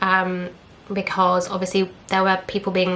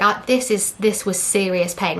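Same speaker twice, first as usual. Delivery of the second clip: usual speaking voice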